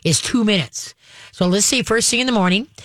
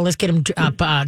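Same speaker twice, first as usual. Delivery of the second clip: normal talking voice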